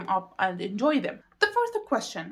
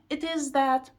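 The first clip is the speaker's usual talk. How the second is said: normal talking voice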